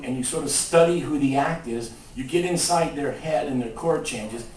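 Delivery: normal talking voice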